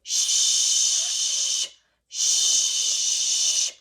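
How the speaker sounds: long hissing shush; loud shush. Shown as a demonstration. Teacher shushes like a librarian. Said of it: "So they say".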